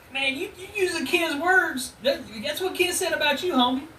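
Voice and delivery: high, excited taunting; high mocking disbelief; incredulous taunt. Real, not acted. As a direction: goad him in a high incredulous voice. Taunting, much higher voice.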